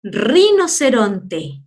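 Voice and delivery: "Rinoceronte" with a hard rolled opening R, drawn out; strong initial R. Shown as a demonstration. Teacher showing pupils the strong R at the start, rolled R. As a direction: slowly roll the R that opens "Rinoceronte". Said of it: "fuerte, al principio".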